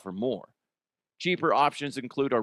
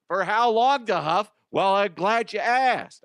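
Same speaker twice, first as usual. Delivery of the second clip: higher, louder mock announcer exchange; hammed-up ad banter; 8 semitones higher, 4 dB louder than usual. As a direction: ham up the sponsor back-and-forth. Playing both sides of ad.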